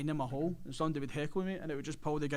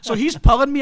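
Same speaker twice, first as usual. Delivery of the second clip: ordinary talk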